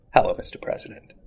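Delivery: whispered menacing greeting; quiet whispered menace; menacing line. Retold or imitated. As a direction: breathe it out softly, like a threat. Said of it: "you go".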